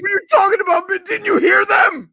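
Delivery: high, loud disbelieving cry; loud high outraged yell; outraged shout. Retold or imitated. Date's outraged reply voiced.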